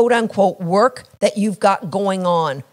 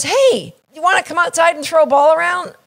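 high, fast, shouting invite; eager friend calling out; 6 semitones higher, faster than usual. Acted. Buddy at the door calls you outside, a fast high shout.

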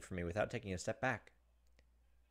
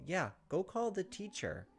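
high, drawn-out appeal; mock urgent urging; 7 semitones higher, slower than usual. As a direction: send them off for help, stretching the words. Urging the onlookers to get help.

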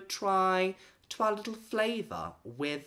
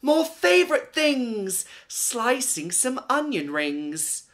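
delighted; 8 semitones higher, 6 dB louder than usual. Acted. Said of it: "she gasped".

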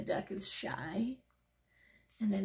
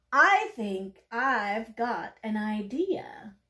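slow, deliberate, louder duck line; thoughtful; 7 dB louder, much slower than usual. Acted. Little duck offering the pigeon an idea, deliberate. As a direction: draw it out as the idea dawns.

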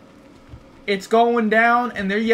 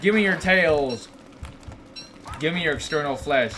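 ordinary talk, nothing marked

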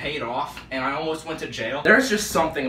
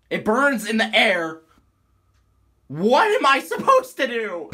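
high, loud frantic pleading; real distress, close to wailing; panicked; 4 semitones higher, 3 dB louder than usual. Real, not acted. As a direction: spiral into panic over the toe. Panicking over bleeding toe.

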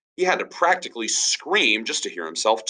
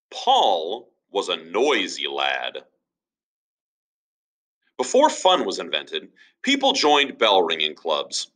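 usual speaking voice

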